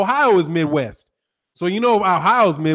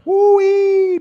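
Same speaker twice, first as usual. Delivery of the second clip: high "Woo-hee" whoop, much higher and louder; excited whoop; 14 semitones higher, 5 dB louder than usual. Real, not acted. Streamer whoops at the boxing feud, high excited hoot. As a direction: let out a high hooting whoop.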